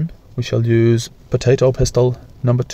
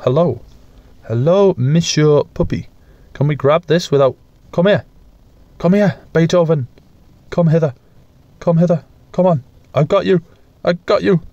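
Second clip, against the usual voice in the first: higher coaxing pet voice; puppy talk; 8 semitones higher than usual. Acted. Calling the puppy over, higher coaxing pet voice. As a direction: coo at the dog to come over.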